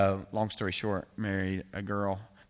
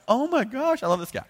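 much higher, louder gushing glee; thrilled delight; 9 dB louder than usual. Retold or imitated. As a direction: squeal it like a fan. Young self thrilled by permission.

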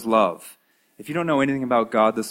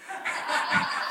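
a quiet laugh at his own joke; soft breathy laugh; 6 dB quieter than usual. Real, not acted. Preacher amused at his own line, soft laugh. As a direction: laugh softly, caught off guard.

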